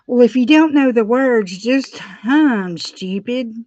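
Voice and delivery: mocking big-brother sneer; brother's taunt. Brother mocking little sister, sneering. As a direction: needle her like a mean older brother. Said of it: "you could hear him going".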